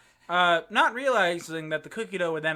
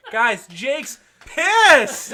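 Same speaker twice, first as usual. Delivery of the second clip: slow, louder teasing announcement; drawn-out taunt; 7 semitones higher, 10 dB louder, much slower than usual. Retold or imitated. The roommate slowly announces to the room to provoke him. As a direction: stretch it out to needle him. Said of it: "He's like".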